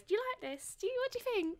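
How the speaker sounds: high-pitched pestering for an opinion; high, needy fitting-room show-off; annoying person. Acted. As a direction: step out and pester them in a high voice. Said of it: "that annoying person that steps out".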